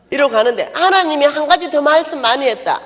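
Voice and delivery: ordinary delivery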